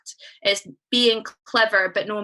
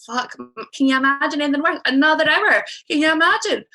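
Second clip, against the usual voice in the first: mock horrified; 5 semitones higher, 4 dB louder, faster than usual. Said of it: "me and Maddie, my friend, were like".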